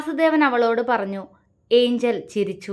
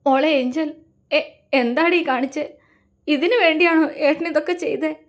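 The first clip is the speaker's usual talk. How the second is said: choked sobbing; 5 semitones higher than usual. A narrator's reading. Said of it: "അവൻ അവളെ നോക്കി വിങ്ങി".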